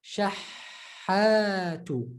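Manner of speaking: Shahatu, very slow, the ha held a bit; held doubled ha. Shown as a demonstration. Teacher showing the held ha without throat pressure. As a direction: hold the doubled ha in Shahatu gently. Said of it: "You just need to hold it a bit".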